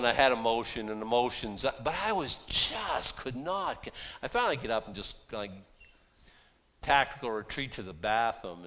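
ordinary talk

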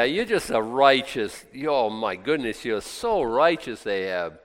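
ordinary talk

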